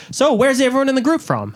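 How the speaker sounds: high, eager mock tour guide. Enthusiastic Spanish guide opening the tour, high mock eager. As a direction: enthusiastic young guide asking the group. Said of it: "he was like".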